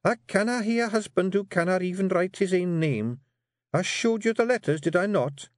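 high Scots wifely voice; a firm wee wife steering her man; Jeanie coaxing. Acted. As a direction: Jeanie urging her husband to write.

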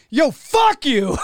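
retelling cursing out the driver who hit him, laughing yell; laughing mock yell. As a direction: bark it out, half laughing. Retold reaction after car crash.

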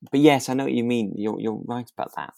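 ordinary talk, nothing marked

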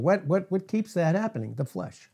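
ordinary talk

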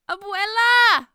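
high, drawn-out scream for help; terrified scream. Acted. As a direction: scream out for grandmother in terror. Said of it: "Maria Lucia screamed".

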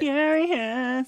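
a high, loud hammed-up "Furious"; mock furious. Cohost jokes about being mad at results, mock furious shout. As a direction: shout the word in playful outrage.

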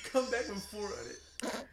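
chuckling through words; laughing. Real, not acted. Laughing while telling.

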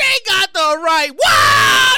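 slow, stretched-out crowing; drawn-out delighted hollering; gleeful holler. Real, not acted. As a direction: draw it out and belt the last word. Relishing the windfall story.

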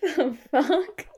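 high, slow disbelief; shocked. Real, not acted. Listener reacts to the creepy story, shocked. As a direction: drag it out in disbelief.

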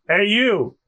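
restrained hail; wrong character take. Acted. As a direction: call out lightly, a little high. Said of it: "Oh, no, that's Luffy".

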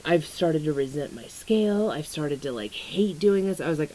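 ordinary talk

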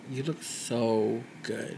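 reacting to a bearded man, soft and slow; admiring. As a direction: quietly admire the bearded look. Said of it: "I'm like".

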